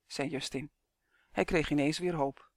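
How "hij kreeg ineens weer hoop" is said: ordinary delivery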